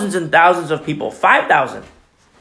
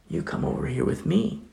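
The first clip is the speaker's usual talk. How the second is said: whispered invitation; 3 semitones higher, 10 dB quieter than usual. Retold or imitated.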